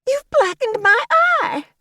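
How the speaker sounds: high, loud slow outraged wail; Violet wailing. A narrator's reading. Violet wails at Agnes clutching her eye, high and slow.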